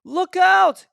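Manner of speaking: high, loud shout; shouted warning. A narrator's reading. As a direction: warn him sharply. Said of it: "he shouted".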